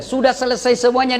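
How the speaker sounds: ordinary talk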